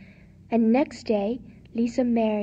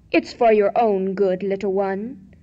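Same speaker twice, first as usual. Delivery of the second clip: soothing, lower kind king; 3 semitones lower, 5 dB louder than usual. Acted. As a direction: comfort her gently as the king. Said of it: "said the kind king".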